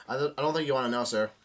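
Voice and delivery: ordinary delivery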